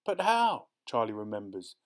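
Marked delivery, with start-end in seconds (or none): 0.0-0.7 put-on high-pitched child's question; dismayed boy. A narrator's reading. Boy realizing he has no bait, dismayed high voice. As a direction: pitch it up high in sudden worry. Boy remembers worms are gone.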